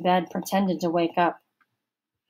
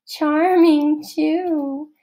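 giggled, much higher; in a giggle; 9 semitones higher, 5 dB louder, much slower than usual. A narrator's reading. Girl giggling to her friend about Josh, higher. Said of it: "she said in a giggle".

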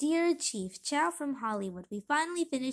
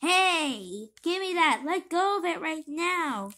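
yelling; 3 semitones higher, 6 dB louder, slower than usual. Acted. Tug of war over letter.

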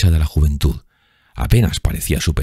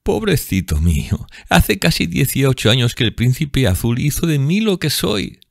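high, scornful voice; mock him bitterly; scornful bar woman; bitter mockery; 11 semitones higher than usual. A narrator's reading.

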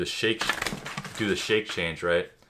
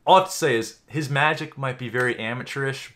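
usual speaking voice

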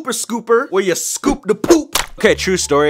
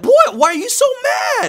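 outraged disbelief; faster than usual. Real, not acted. Outraged at boy throwing dog.

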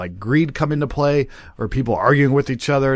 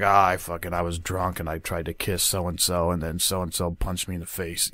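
low, soft mumbled admission; imitated hungover confession, low and quiet; sheepish morning-after confession; 6 semitones lower, 8 dB quieter than usual. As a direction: play someone owning up the morning after.

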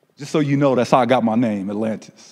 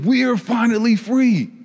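higher, lifted inner thought of freedom; inner joy; 8 semitones higher than usual. Acted. Carpet echoing the family's news to itself, joyful. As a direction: let the carpet's own joy rise up. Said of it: "I'm thinking".

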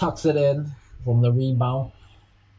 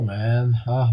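usual speaking voice

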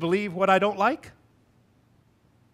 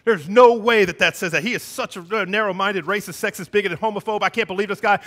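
retold outrage; 4 semitones higher, 7 dB louder than usual. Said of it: "She'd be like".

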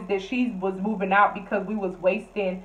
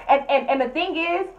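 ordinary delivery

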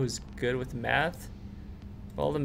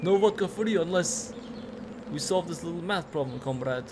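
mock Russian accent; 3 semitones higher than usual. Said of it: "wrong accent".

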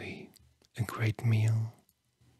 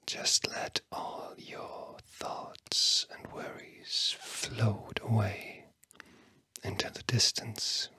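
whispering. Real, not acted. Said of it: "words that I'm whispering to you".

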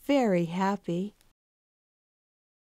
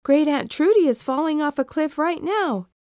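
urgent; 7 semitones higher, 4 dB louder than usual. Acted. Pleading with his family.

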